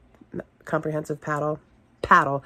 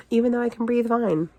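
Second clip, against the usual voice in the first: usual speaking voice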